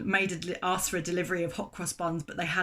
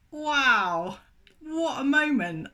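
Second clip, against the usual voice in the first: slow, high, breathless wonder; awed; 7 semitones higher, 4 dB louder, much slower than usual. Retold or imitated. Narrator stunned that the baker is from Jerusalem, slow and awed. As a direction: let the awe stretch out slowly.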